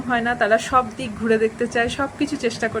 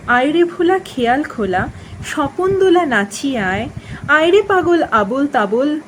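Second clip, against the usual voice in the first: lilting, rhythmic verse reading; poem recitation; 3 semitones higher, 4 dB louder than usual. Acted. Reciting the book's opening nonsense poem to listeners, lilting and rhythmic. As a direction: recite in a swinging, emphatic metre.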